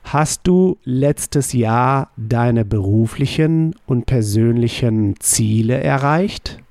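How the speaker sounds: slow German question, rising at the end; slow model question. Shown as a demonstration. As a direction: slowly model the full sentence. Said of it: "How would you say".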